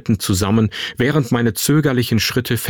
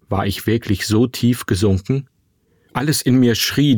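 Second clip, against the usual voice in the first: ordinary delivery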